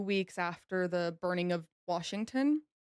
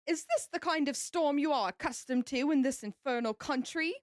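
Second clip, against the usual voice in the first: put-on British admiral; British accent; 5 semitones higher than usual. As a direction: question it as an indignant British admiral. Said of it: "I wanna do this in a British accent".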